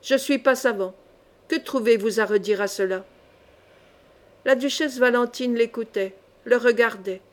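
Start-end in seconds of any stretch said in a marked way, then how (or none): none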